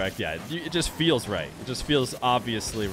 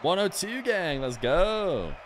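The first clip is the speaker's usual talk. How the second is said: hyped cheer; 8 semitones higher, much slower than usual. Real, not acted. Hyped about same LAN section.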